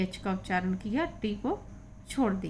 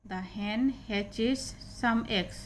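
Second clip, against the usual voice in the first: very slow, stretched reading; slow model sentence; slow reading aloud; much slower than usual. Shown as a demonstration. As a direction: read word by word for the class. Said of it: "teacher reads slowly".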